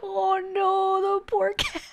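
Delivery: high-pitched aww-poor-thing dismay; pitying whine. Real, not acted. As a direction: feel sorry in a squeaky high voice. Laughing, pitying the cat.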